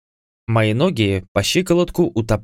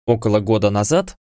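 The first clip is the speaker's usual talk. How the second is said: normal talking voice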